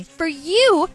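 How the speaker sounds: loud, high, indignant; outraged retort. Acted. Daughter confronting her mother in public, outraged. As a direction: spit it back at her in disbelief.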